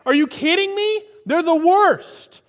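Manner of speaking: high, loud, slow outraged disbelief; hammed-up prophet refusing his mission; indignant Jonah. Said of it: "I picture Jonah saying the same thing".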